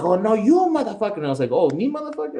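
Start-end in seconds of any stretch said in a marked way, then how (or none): 0.0-1.2 high, loud mock outrage; mad clerk imitation. Clerk throwing the insult back at customer, mock outrage. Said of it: "he's all mad". 1.5-2.4 very high, incredulous echo; mock affronted. Customer answering the clerk's insult, mock affronted.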